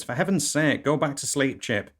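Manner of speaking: high-pitched scolding wife voice; exasperated wife. Acted. Wife to husband woken in the night, exasperated. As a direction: scold a spouse, pitched well up.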